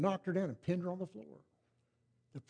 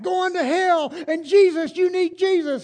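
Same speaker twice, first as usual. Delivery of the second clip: louder, higher preacher holler; mock radio hollering; 11 semitones higher, 9 dB louder, slower than usual. Radio preacher hollering at the boys, loud and high. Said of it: "preacher on the radio hollering".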